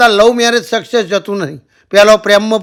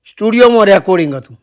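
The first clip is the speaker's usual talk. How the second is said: ordinary talk, nothing marked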